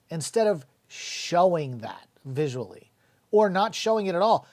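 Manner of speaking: ordinary delivery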